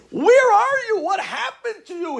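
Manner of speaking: very high, frantic shout; going crazy. Retold or imitated. Uncle going crazy on his nephew, shrieked questions. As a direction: demand an answer at full pitch. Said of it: "My uncle was going crazy on me".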